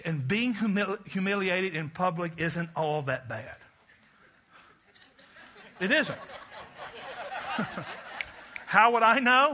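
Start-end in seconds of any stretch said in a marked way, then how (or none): 6.2-8.2 a quiet unvoiced chuckle; soft breathy laugh. Real, not acted. Preacher chuckling softly about public humiliation.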